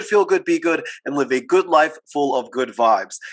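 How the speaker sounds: polished scripted host welcome; podcast intro. Acted. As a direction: introduce the show like an announcer.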